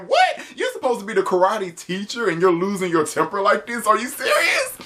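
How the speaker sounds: incredulous outrage. Real, not acted. Outraged at karate teacher Blaze.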